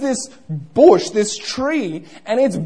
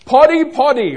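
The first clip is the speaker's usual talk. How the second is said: raised, louder summons; calling out; 4 semitones higher, 7 dB louder than usual. Acted. Tree calling a listener by name, calling out. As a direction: call out the name twice.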